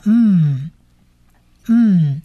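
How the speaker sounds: 嗯 as a held M hum, mouth closed; closed-mouth nasal. Shown as a demonstration. Teacher showing final mem's sound, 嗯 as a nasal hum. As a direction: hum 嗯 through the nose with lips shut. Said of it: "它发鼻音的".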